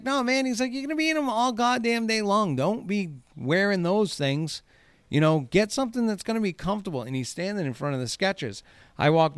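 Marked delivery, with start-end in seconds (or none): 0.0-0.4 high-pitched mock dad yelling down; dad hollering at his kid, pitched way up; dad calling down. Burly dad yells down at his kid in the shoe store, high mock holler. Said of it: "he yells down at his kid". 0.7-7.0 high-pitched mock dad lecture; dad calling down. Burly dad warns his kid about all-day shoes, high mock holler. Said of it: "he yells down at his kid".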